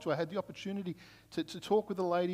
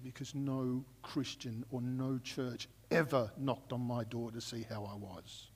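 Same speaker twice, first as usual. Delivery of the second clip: slow, low, hushed and bitter; a woman's quiet anger at being ignored by the church; bitter, quiet retort; 5 semitones lower, 6 dB quieter than usual. Retold or imitated. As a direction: let her resentment come out slow and soft. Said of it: "there was such anger in her face".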